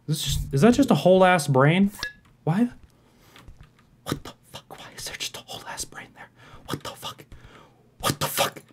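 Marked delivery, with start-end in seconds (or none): 4.0-8.6 breathless unvoiced disbelief; stunned whisper. Real, not acted. Gamer finding a brain in a dark hallway, stunned whisper. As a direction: whisper it, shocked by what you see.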